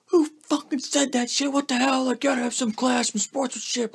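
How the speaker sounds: very high, hammed-up yelling coach; mock furious coach. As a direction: imitate the coach ranting at the team. Crazy coach ripping his buddies.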